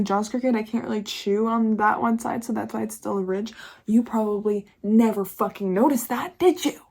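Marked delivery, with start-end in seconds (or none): none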